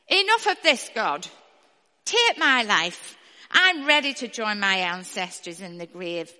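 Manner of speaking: loud, anguished plea to God; despairing prophet. Acted. Elijah begs God to die.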